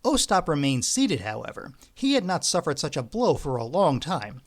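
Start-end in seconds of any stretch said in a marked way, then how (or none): none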